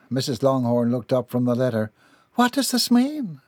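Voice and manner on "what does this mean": high-pitched, dismayed mother; distraught question. A narrator's reading. Distraught mother questions letter's meaning.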